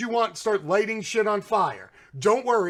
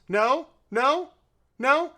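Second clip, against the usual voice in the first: each No? climbing higher and louder; mock questioning; 6 semitones higher, 3 dB louder, slower than usual. Taunting the media with rising sarcastic questions. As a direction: push every No? up a step.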